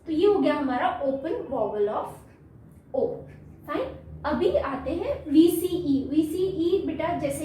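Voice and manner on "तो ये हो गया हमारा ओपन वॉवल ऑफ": usual speaking voice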